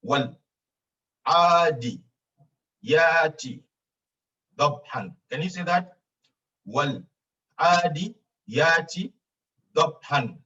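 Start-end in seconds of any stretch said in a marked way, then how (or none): 0.0-5.1 syllable by syllable. Shown as a demonstration. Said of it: "Say it faster". 6.6-10.4 slow, broken up with long pauses; syllable by syllable. Shown as a demonstration. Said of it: "Say it faster".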